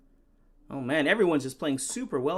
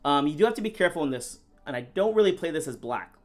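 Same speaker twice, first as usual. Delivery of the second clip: normal talking voice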